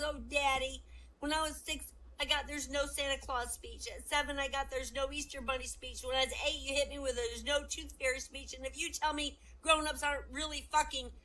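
put-on high-pitched whining child; distraught little boy. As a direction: plead to Dad in an upset kid's voice. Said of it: "The boy goes".

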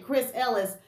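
ordinary talk, nothing marked

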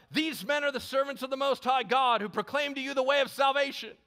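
high, loud, sustained proclamation; crying out. A narrator's reading. Slave girl with a demon trailing Paul, crying out. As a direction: shout it out in one long high stream. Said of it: "crying out".

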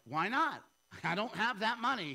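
hammed-up bargaining; mock exchange with God. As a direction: play both sides of the argument. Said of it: "He's like".

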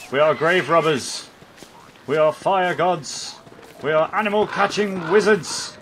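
loud, proud proclamation, slowing on the big lines; hammed-up heroic boast to Chester; grandiose boasting. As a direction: declare it like a conquering king.

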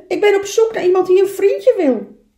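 high, loud small animal; little beaver voice. Acted. Little beaver asking a lone duck, high loud voice. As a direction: pipe up eagerly in a high, loud voice.

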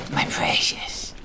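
slow, whispered creepy creature; Gollum voice. Pretend. Eyeing the bike, a slow whispered Gollum voice. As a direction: hiss it out like Gollum hugging his treasure.

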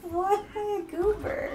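high, laughing disbelief; surprised laugh. Real, not acted. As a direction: react in amused disbelief. Reacts to being called goober.

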